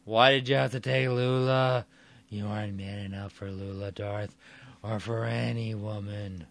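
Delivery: a hint of a bitter drunk; drunken ranting. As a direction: Mike Mooney ranting at Darth Fable. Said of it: "Mike Mooney continues talking".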